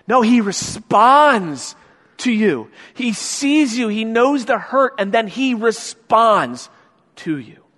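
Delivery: slow climb to a pulpit peak; preaching at full force. Real, not acted. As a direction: lift the room on each phrase. Preacher building to a point.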